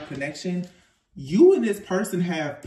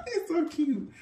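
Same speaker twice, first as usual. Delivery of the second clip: still laughing; 11 semitones higher than usual. Real, not acted. After laughing.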